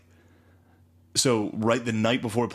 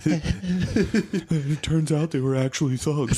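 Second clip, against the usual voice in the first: slow, pitched-up mock documentary line; mock solemn narrator; 6 semitones higher, much slower than usual. Friend mocking the show's narration, slow and high. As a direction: drag it out like a smug narrator. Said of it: "mocking show narrator".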